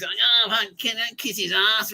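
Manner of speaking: mock grovelling. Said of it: "he, like, goes".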